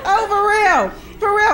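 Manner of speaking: ordinary talk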